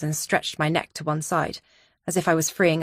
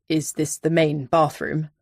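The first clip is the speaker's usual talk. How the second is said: Donovan voice; 2 semitones lower than usual. A narrator's reading.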